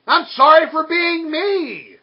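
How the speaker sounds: high, slow, pleading mock voice of a begging boyfriend; mock whiny apology.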